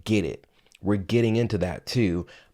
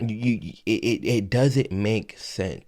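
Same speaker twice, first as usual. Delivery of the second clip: ordinary talk, nothing marked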